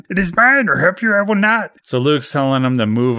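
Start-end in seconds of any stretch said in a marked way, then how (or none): 0.1-1.7 high, squawking little creature voice; Yoda. Retold or imitated. Yoda refusing Luke's R two the flashlight, high-pitched mock yelling. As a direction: protest in a squeaky puppet voice. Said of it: "And Yoda yelling".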